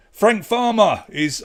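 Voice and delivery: high, loud and slow, a silly dramatic voice; odd put-on voice. Quiz host blurting the answer to players in a silly put-on voice. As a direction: lingers on the name in a mock-grand voice. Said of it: "I don't know why I said it like that".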